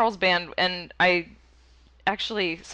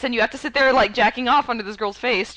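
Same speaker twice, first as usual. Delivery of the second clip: normal talking voice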